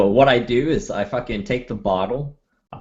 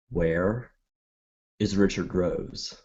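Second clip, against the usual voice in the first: menace; 5 semitones lower, 6 dB quieter, slower than usual. Acted. Gangster threatens at gunpoint.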